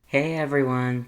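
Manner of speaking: ordinary delivery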